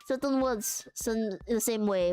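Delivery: put-on French accent, halting as it explains; hammed-up French, dropped H's, searching for words. Shown as a demonstration. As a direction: teach in a simple stage French accent. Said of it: "That is how you do a, a very simple French accent".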